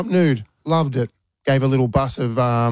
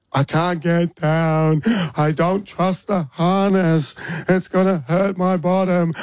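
put-on high, slow, whiny child voice; a dad mimics his stuck daughter pleading on the wall; imitating crying daughter; 5 semitones higher, slower than usual. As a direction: act out the frozen, frightened little girl. Dad voicing crying frozen daughter.

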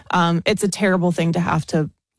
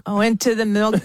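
Aussie accent; 3 semitones higher than usual. Shown as a demonstration. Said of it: "how is your Aussie accent going".

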